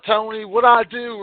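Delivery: normal talking voice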